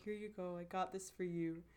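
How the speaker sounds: quietly imitated gift giving; soft shy offer. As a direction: hand it over quietly, almost shy. Boy shyly giving gift.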